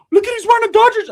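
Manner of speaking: high, loud angry squeal; Ricky yelling. Retold or imitated. Ricky points at the rival's Dodgers jersey, high loud yell. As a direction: shriek it at the jersey.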